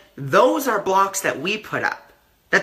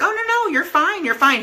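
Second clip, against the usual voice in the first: high-pitched reassurance; 11 semitones higher than usual. Retold or imitated. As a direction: voice jumps way up, oh no you're fine. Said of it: "And I was like".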